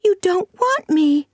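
put-on high, loud wail of a child; acted child's anguished cry. A narrator's reading. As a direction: burst out as a distraught little girl. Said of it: "cried the child suddenly".

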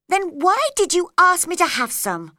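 high, loud indignant question; cross. A narrator's reading. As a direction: demand it crossly, voice climbing high. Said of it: "said Alice crossly".

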